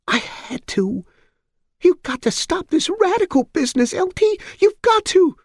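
put-on high, pleading old mother voice; high begging old woman.

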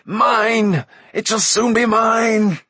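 very high, loud greedy exulting; tight, high-pitched cry. A narrator's reading. Mag claiming the kingdom, shrieked greedily. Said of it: "in a tight, high-pitched voice".

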